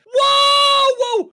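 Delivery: high, loud, drawn-out whoa; startled yell. Acted. Player startled by the hooting bird, startled yell. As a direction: cry out in sudden surprise.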